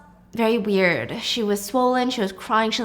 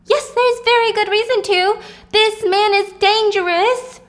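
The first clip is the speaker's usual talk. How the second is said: high-pitched, urgent cry; frantic insistence; 10 semitones higher, 4 dB louder than usual. A narrator's reading. Wife contradicts manager about dangerous husband, frantic.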